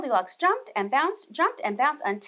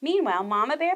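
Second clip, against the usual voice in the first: plain reading voice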